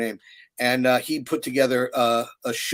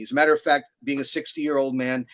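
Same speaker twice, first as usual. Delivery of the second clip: ordinary talk, nothing marked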